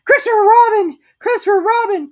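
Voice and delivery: very high, loud relieved calling; crying out. A narrator's reading. Piglet crying to Christopher Robin. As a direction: call out for rescue. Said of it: "cried Piglet".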